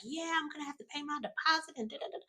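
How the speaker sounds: high, fast whiny mock complaint trailing into da da da; fast high whiny mock of an annoyed girlfriend; mocking rant. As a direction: make her nagging sound silly.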